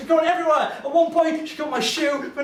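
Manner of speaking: frantic retelling. Retold or imitated. Frantic retelling of vomit chaos.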